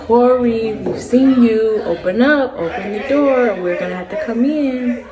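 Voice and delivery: lightly voiced friend demanding Corey open up; a hint of a firm knock-knock call; friend at the door. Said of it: "Andreas was like".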